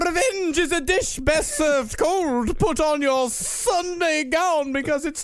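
high, slow, laughing Arnold imitation; Arnold Schwarzenegger accent. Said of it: "is Arnold Schwarzenegger in the room".

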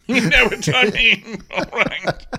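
high, laughing through the words. Acted. Councillor laughing at his own joke, high-pitched. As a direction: crack up as you say it.

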